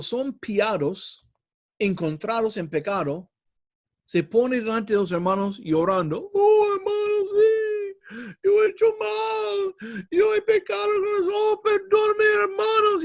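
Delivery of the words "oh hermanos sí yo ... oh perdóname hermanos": much higher, wailing hypocrite pleading with the brothers; mock tearful confession. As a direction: ham up a fake sobbing apology to the church. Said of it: "Llorando así". (confesión llorosa fingida, muy aguda y teatral)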